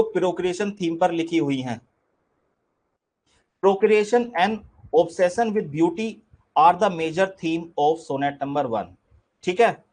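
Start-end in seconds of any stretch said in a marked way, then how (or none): 3.6-8.9 slow dictation. Shown as a demonstration. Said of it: "teacher dictates theme slowly".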